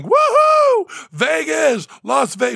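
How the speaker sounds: loud high whooping shout; celebratory yell. Retold or imitated. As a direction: whoop it up like a party-goer in Vegas. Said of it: "I had to be yelling".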